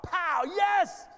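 high, loud victory yell; jubilant shout. Acted. Husband high-fiving his wife over a job offer, jubilant shout. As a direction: whoop it out in triumph.

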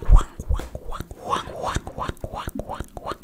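whispered name repeated as an ASMR trigger; repeated trigger word. Shown as a demonstration. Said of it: "It's Juan".

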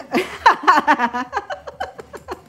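long, loud, high-pitched laugh; real burst of laughter. Real, not acted. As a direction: crack up loudly mid-sentence. Cracks up at bathroom question.

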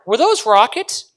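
put-on high, slow, wondering child; young girl voice. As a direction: ask it wide-eyed as a kid. Child asks about the smoke.

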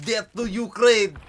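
slow, loud, high fake Russian cry; mock Russian accent. Gamer faking Russian for a challenge, mock Russian accent. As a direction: shout it like a hammed-up Russian. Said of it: "in a Russian accent".

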